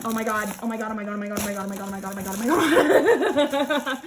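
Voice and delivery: rapid-fire repeated gushing ending in laughter; giddy excitement. Real, not acted. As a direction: rattle it off giddily until a laugh takes over. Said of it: "Can you tell I'm excited".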